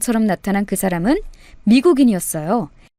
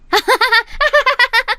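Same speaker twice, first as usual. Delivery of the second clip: a bright, high laugh; laughing along with her friend; high burst of laughter; 12 semitones higher than usual. Acted. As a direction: break into high, giddy laughter.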